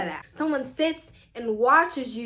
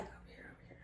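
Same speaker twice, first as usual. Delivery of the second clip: tiny whispered call; hushed imitation; 30 dB quieter, much faster than usual. Girl in the bathroom directing her to towels, whispered. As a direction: whisper it, almost inaudible.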